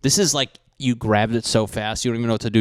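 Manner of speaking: ordinary talk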